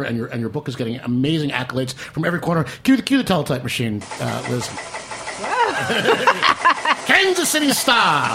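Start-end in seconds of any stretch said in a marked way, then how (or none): 4.7-7.0 imitated teletype noise. Teletype sound after cue. 7.1-8.4 put-on slow, high old-timey newsreel announcer; 1950s narrator delivery; Walter Winchell voice. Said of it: "I wanna do my Walter Winchell voice".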